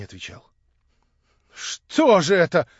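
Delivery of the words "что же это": slow, louder worried question; uneasy, unsure what awaits him; anxious. A narrator's reading. Young man alarmed by the silence, anxious question. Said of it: "спросил он с беспокойством".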